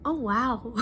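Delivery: normal talking voice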